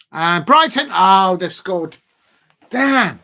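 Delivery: real shock. Real, not acted. Fan watching his team concede live, real loud dismay. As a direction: react as the goal goes in. Opponent scores during live stream.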